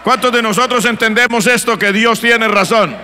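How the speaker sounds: loud, emphatic pulpit proclamation; preaching at full force. Real, not acted. Preacher asking who believes God is right, loud preaching. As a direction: drive it home to the congregation, loud.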